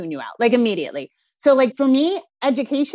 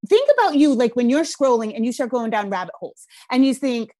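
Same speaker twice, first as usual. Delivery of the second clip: usual speaking voice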